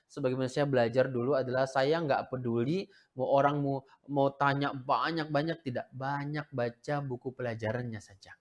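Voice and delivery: ordinary delivery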